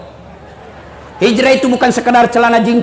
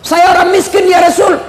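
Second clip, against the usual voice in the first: pleading petitioner; 6 semitones higher than usual. Retold or imitated. Man pleads poverty to Prophet.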